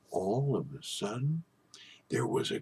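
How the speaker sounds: breathy storyteller's hush; hushed whisper. A narrator's reading. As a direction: whisper it, building suspense. Suspense before the thunder.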